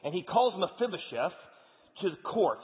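high, loud preaching retelling the story; fired-up storytelling. Acted. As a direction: build the drama like a pulpit storyteller. Preacher retells Old Testament story.

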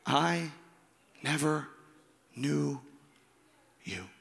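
word by word with long pauses; grave, falling away softly on the last word; solemn pronouncement. Acted. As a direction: pronounce judgement slowly, each word alone. Solemn quote of divine rejection.

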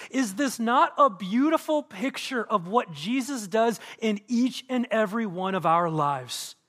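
ordinary delivery

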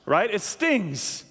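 usual speaking voice

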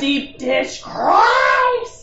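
high, slow, drawn-out exclamation; mock reverent shout; exclaim. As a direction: proclaim it like a holy cry. Exclaiming the new phrase.

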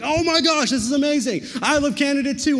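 retold burst of hype; gushing excitement. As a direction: gush like an overexcited big kid. Gushes back at the boys.

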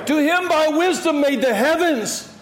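high, full-voiced psalm proclamation; exuberant praise shout. Shown as a demonstration. Preacher calling out psalm verses to the congregation, exuberant praise shout. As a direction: call out each verse with fervor.

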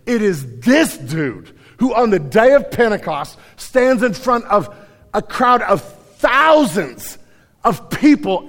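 high, impassioned pulpit delivery; full-force preaching. Real, not acted. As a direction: preach it out with mounting intensity.

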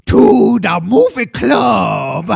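slow, loud showman intro; announcer voice. Acted. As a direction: announce it like a game show host. Announcer-style show intro.

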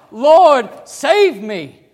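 loud, high desperate cry. Shown as a demonstration. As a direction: shout it like a drowning man. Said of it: "You're crying out".

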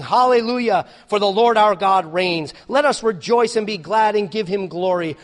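loud, high proclamation of praise; ringing, jubilant full voice; exultant shout. Acted. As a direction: shout it like a rejoicing multitude.

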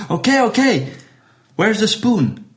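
high-pitched giving in; exasperated waiter. Acted. Waiter finally agrees to taste soup, high exasperated concession.

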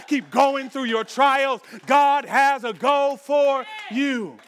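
climbing from strong to high, loud shouting; building preacher's shout. Acted. Pastor rousing the struggling believers, climbing to a shout. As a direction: build it up and hammer it home.

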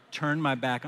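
ordinary talk

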